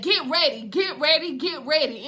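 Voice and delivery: loud, rallying preacher's refrain; T.D. Jakes. Acted. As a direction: hammer it out like a famous revival preacher. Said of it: "in my T D J's voice".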